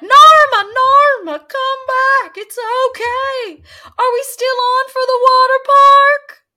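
high, loud, cheery calling out; mock shouting after her. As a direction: shout after the fleeing car as if nothing happened. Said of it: "And Lynette's like".

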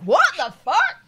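high, loud disbelief; incredulous outburst. Retold or imitated. Retelling reply to the landlady, high loud disbelief. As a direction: scoff at nonsense. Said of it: "And I was like".

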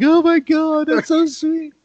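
high, far-off squeal of sweet delight; gushing high. Retold or imitated. Friend imitating himself gushing at Ellen videos, high squeal.

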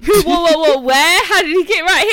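loud, high burst of confusion; baffled. Retold or imitated. Retelling mishearing Panda Boy to co-host, baffled. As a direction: fire out mock-bewildered questions. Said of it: "and I was like".

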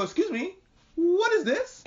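high, prim questioning cop; squeaky mock cop asking about the stall. Voicing a passing cop.

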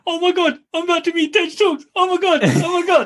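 high, breathless fangirl gush; starstruck squeal. Retold or imitated. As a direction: squeal like meeting your idol. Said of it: "she goes".

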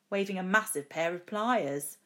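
ordinary delivery, nothing marked

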